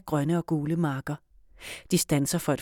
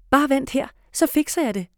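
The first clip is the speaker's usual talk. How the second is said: eager; 8 semitones higher, 4 dB louder than usual. A narrator's reading. Girl taking charge eagerly.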